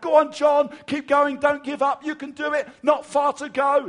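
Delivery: high, loud calls of encouragement; crowd shouting. Retold or imitated. Crowd at the marathon cheering John on, loud and high. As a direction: yell it like spectators at the roadside. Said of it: "voices of people shouting out".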